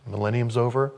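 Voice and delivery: ordinary talk, nothing marked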